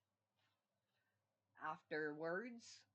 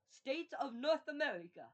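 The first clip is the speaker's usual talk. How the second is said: higher and louder, scoffing; mocking disbelief; 10 semitones higher, 10 dB louder than usual. Real, not acted. Mocking a silly name for the United States, scoffing high and loud.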